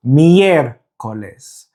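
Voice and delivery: very slow "Miércoles", broken after a long "Miér"; stress on first syllable. Shown as a demonstration. Teacher modelling the word for learners, "Miércoles" slowly with stress on "Miér". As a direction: hold "Miér", then let "coles" drop. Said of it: "So, the pronunciation is".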